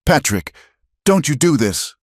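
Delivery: high, pleading scream. Acted. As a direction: scream to stop him shooting. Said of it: "Martha screamed at the sheriff".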